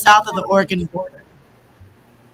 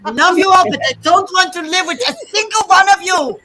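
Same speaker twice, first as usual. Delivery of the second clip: put-on high, loud, laughing Swedish Chef accent, a touch of it; hammed-up Swedish Chef accent, giggling through; laughing through a bad Swedish Chef accent; mock Swedish Chef accent; 9 semitones higher, 4 dB louder than usual. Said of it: "Say that again in Sesame Street Swedish voice".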